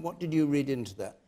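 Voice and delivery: normal talking voice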